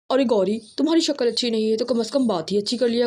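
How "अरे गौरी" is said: ordinary delivery, nothing marked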